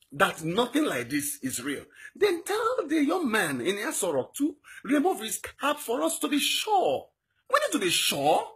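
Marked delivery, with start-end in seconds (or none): 2.2-8.4 high, slow, tearful pleading; crying plea. Real, not acted. Activist pleading with the nation, voice breaking into tears. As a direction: beg for proof through rising tears.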